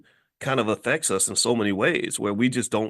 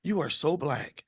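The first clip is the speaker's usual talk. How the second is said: softer, slow pitying coo; mock pity; 3 semitones higher, 5 dB quieter, slower than usual. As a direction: coo it like comforting a hurt child. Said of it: "They, they say".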